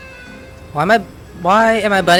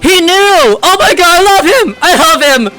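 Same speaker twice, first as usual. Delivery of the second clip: loud, high-pitched fan squeal of delight; excited gushing; 7 semitones higher, 4 dB louder than usual. Real, not acted. Streamer reacting to a beloved game character, excited gushing. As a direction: gush with sudden loud adoration.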